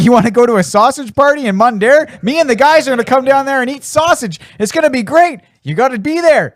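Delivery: high, pushy party invitation; Messier impression. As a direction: hype up the sausage party in a high, eager voice. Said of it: "And then he's like".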